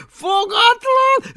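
very high, loud slow shout with laughter; shouting mockery. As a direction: yell the nickname gleefully. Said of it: "kiáltotta Takonypóc". (harsány, nevető kiáltás)

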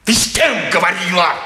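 sharp suspicious shout at her; angry shout. Acted. Father shouts at daughter over the fence talk, angry. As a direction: demand it loudly, accusing.

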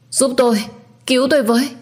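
high, loud cry for help; desperate scream for help. A narrator's reading. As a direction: scream for rescue as if in danger. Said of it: "Tiếng hét của Ánh rất lớn". (tiếng hét cầu cứu thất thanh)

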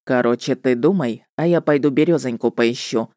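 low, matter-of-fact male voice; businesslike witcher. A narrator's reading. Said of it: "снова деловито сказал он".

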